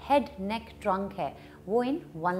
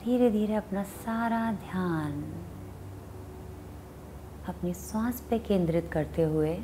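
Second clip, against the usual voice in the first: guided meditation; 2 semitones lower than usual. Acted. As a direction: guide them unhurriedly into watching the breath. Leads a meditation.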